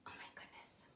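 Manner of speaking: gasped whisper of dismay; hushed shock. A narrator's reading. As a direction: whisper it as if scandalised. Mock shock after the porridge.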